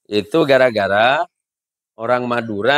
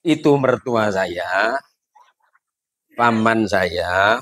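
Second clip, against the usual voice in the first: slow innocent listing; 5 semitones lower, slower than usual. Acted. Driver lists relatives.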